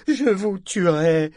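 high, loud and slow, stress on je; furious threat. Acted. Parangon threatens to kill them, furious. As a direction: spit out je vous tuerai as a threat.

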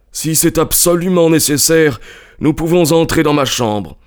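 high-pitched, irritable character voice; testy householder relenting; grudging consent. A narrator's reading. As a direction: give in grudgingly, pitched well up. Irritated Cunningham grudgingly agrees.

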